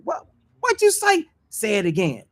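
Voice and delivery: high, squeaky challenger voice; mock street challenge. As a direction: play the guy picking a fight. Acted quarrel voice.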